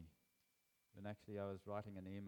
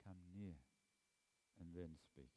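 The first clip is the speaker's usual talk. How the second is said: soft and close; 3 semitones lower, 9 dB quieter than usual. Shown as a demonstration. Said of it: "we yell at God from a distance".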